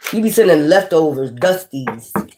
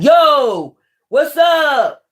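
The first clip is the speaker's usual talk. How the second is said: mock guy hollering; 7 semitones higher, much slower than usual. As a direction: imitate a guy calling out to a woman. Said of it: "approach you like that, like".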